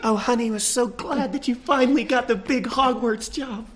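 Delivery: high, soft spousal voice; high little well-wishing voice; proud scorpion wife. Acted. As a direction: coo softly to a dear husband.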